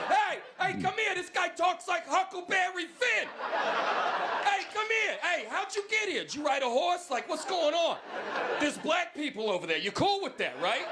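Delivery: high, shouted imitation of a gawking New Yorker; mock New Yorker. New Yorkers gawking at the Southern accent, high mock shout. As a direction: call your buddies over to gawk, shouting high. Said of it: "they're like".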